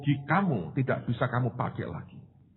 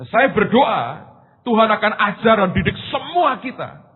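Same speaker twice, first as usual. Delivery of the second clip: preaching; 7 semitones higher, 6 dB louder than usual. Acted. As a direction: press the point home to the congregation. Berkhotbah dengan suara penuh. (berkhotbah lantang dan lambat)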